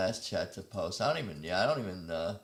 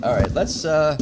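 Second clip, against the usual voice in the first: loud; 4 semitones higher, 13 dB louder than usual. Real, not acted. Loud transition.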